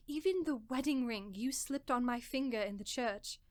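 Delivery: usual speaking voice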